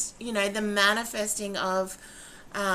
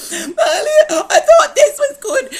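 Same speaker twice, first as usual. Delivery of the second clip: much higher, louder, laughing mock wail; laughing cry; 16 dB louder than usual. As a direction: squeal it through laughter. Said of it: "And then she's like".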